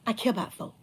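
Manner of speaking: ordinary talk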